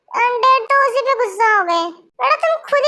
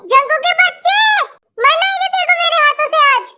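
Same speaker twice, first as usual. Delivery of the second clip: angry scolding; 6 semitones higher than usual. Acted. Mother storms in scolding son.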